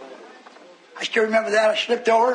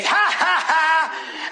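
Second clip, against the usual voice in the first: raised, taunting "ha, ha, ha"; mocking devil laugh; 6 semitones higher, much slower than usual. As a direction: imitate a devil laughing at the preacher. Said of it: "That devil would laugh at me".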